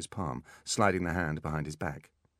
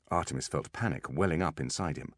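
ordinary delivery, nothing marked

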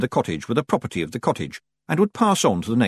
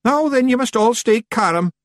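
high, loud Scots yell; urgent high-pitched holler; 13 semitones higher, 5 dB louder than usual. A narrator's reading. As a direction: yell over a panicking crowd as a wee Scotsman. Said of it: "yelled Rob Anybody".

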